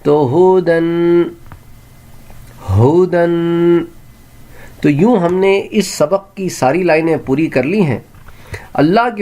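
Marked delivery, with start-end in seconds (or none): 0.3-3.8 ہدن said slowly with the ya silent, hu-dan. Shown as a demonstration. Teacher to pupils, slow word with silent ya. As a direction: sound out ہدن slowly, ya not voiced. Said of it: "یا کی تو sound ہو جائے گی بالکل ساکن".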